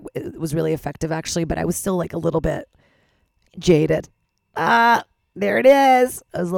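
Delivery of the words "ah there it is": loud delighted shout. Real, not acted. Reacts to rowdy crowd.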